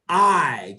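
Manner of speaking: "I" as a full, loud eye sound. Shown as a demonstration. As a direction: punch out "I" like the word eye. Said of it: "Say it like".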